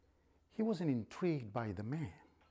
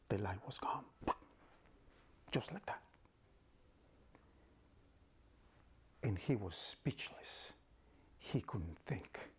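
whispered storytelling; 3 semitones lower, 5 dB quieter than usual. A narrator's reading. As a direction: whisper it in awe. Hushed narration.

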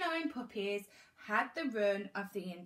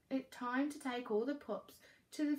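ordinary delivery, nothing marked

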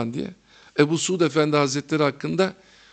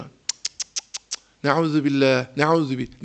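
disapproving teacher. Retold or imitated. Old teacher scolding a laughing student, a hint of tutting reproach. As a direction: click the tongue in dismay, then chide gently. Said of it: "Böyle konuşurdu".

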